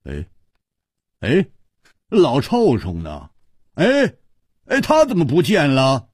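high-pitched calling out in bursts; sudden loud shout. A narrator's reading. Hu Tiehua searching for his vanished friend, loud calling. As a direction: call out for a missing friend, voice jumping up. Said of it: "胡铁花忽然大声地喊".

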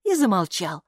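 ordinary delivery, nothing marked

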